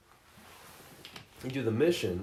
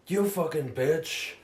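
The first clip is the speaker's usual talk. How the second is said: mock Keanu snarl; 5 semitones higher, slower than usual. Keanu voiced from the game.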